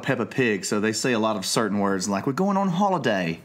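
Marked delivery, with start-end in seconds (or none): 2.3-3.4 high-pitched American go at English; attempted English accent. Shown as a demonstration. American dad quoting Peppa Pig words on request, attempted English accent. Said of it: "When I try to do English accent".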